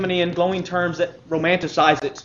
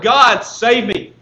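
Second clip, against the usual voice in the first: cry for rescue; 6 semitones higher, 7 dB louder, much slower than usual. Acted. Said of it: "as he cries out".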